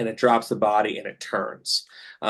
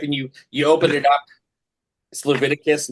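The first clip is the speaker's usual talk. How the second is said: ordinary talk, nothing marked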